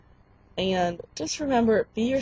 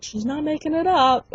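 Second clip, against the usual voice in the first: higher-pitched imitation of a sister; insistent sister; 7 semitones higher, 4 dB louder than usual. Retold sister arguing back.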